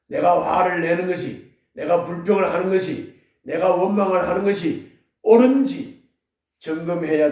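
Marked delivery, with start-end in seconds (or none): none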